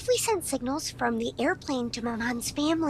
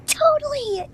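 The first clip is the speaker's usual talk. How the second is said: excited; 12 semitones higher, 8 dB louder, slower than usual. Acted. Excited at memory.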